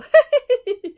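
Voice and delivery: high loud burst of giggling; giggle. Acted. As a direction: break into a giggle. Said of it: "He began to giggle".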